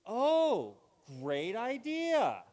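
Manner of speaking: high, bright, genuinely pleased oh great idea; really mean it, delighted; sincere enthusiasm. Shown as a demonstration. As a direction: enthusiastic agreement. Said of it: "we would say".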